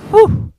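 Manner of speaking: high loud relieved whistle-breath; exaggerated sigh of relief; relieved whew. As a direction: blow out relief. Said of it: "you sat there going".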